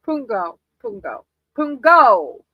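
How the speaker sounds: fumbling for how to say Pungo; trying out a name. Shown as a demonstration. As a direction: test Pungo again and again until one sticks. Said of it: "Sorry if I'm mispronouncing it".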